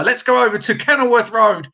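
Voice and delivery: loud, high stadium announcer voice; football announcer. Shown as a demonstration. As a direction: call it out like a match announcer. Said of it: "I sound like the football announcer".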